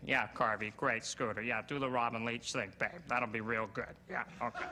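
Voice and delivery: low, offhand encouragement from a showbiz buddy; lightly voiced showbiz pal. Voices Dennis requesting the impression.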